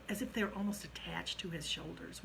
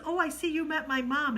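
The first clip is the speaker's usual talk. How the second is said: much higher and louder, cheerful hello; bright excited greeting; 9 semitones higher, 8 dB louder than usual. Retold or imitated. Rachel cheerfully greeting the man, much higher and louder. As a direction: chirp it out, delighted to see them.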